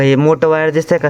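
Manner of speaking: normal talking voice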